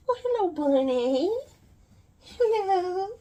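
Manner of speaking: high, loud cooing greeting; sing-song hello to a pet. Real, not acted. Greeting the bunny at her ankles, high and cooing.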